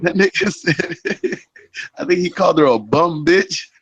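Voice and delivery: talking through laughter; laughing. Real, not acted. Laughing while retelling the video.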